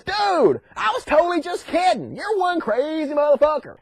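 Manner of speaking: high-pitched mock disbelief; incredulous protest. Voice in Abraham's head stopping him, incredulous. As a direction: backpedal in hammed-up alarm. Voice stops Abraham stabbing son.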